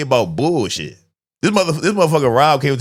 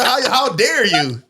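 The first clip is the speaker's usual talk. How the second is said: mock indignation; 8 semitones higher than usual.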